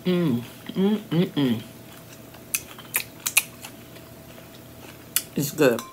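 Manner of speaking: chewing while humming; eating lettuce wrap; mouth full, chewing. Real, not acted. Seen 1: Bites the wrap at -1, then chews with closed, bulging mouth.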